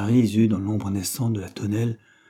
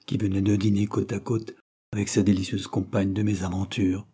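plain reading voice

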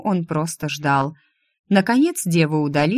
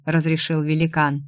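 ordinary delivery, nothing marked

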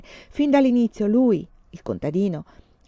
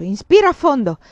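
loud urgent command; shout; 6 semitones higher, 8 dB louder than usual. A narrator's reading. Farmer shouting at the lord before the canal, urgent command. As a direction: bark the order before the plunge. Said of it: "gridò".

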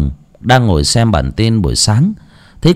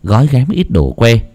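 plain reading voice

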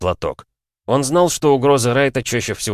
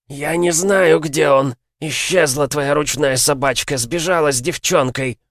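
spiteful werewolf; 5 semitones higher than usual. Acted. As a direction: spit it out spitefully. Werewolf spits back at demon. (злобно и громко, с издёвкой)

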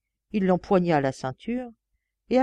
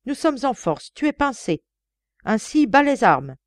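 loud, raised warning voice; stern warning; 6 semitones higher, 5 dB louder than usual. A narrator's reading. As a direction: warn him off, voice raised. Inspector confronts armed killer.

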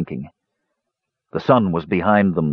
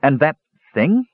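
hesitant, higher-pitched question; faltering; 7 semitones higher than usual. A narrator's reading. Young mage afraid to ask about the thing, faltering. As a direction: trail into a question you dread asking. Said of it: "Palin's voice failed".

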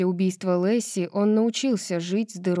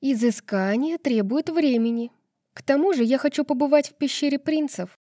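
higher, prim lecturing schoolmarm; prim schoolteacher tone; 6 semitones higher than usual. A narrator's reading. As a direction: lecture it like a pedantic teacher. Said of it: "тоном занудной училки произнесла".

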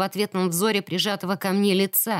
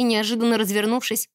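ordinary delivery, nothing marked

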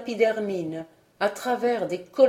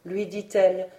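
usual speaking voice

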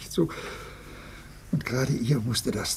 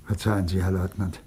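suddenly lower, subdued voice; changed voice; 6 semitones lower than usual. Acted. Old father waking from his reverie to apologise, lower changed voice. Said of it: "Mit einer ganz anderen Stimme".

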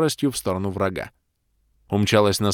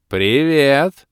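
high, loud, bright greeting; cheerful demoness; 6 semitones higher, 7 dB louder than usual. A narrator's reading. Demoness cheerfully greeting the party, high and loud. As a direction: chirp a seductive hello. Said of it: "радостно улыбнулась нам демоница".

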